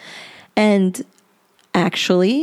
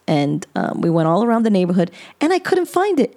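usual speaking voice

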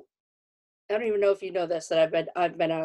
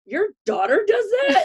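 much higher, astonished rising question; surprised coworkers; 13 semitones higher than usual. Retold or imitated. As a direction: gasp it out in disbelief.

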